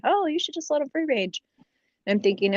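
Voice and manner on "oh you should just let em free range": high, mocking quote of busybody advice; mocking know-it-all. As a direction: parrot the advice in a sing-song mock. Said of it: "people tell me".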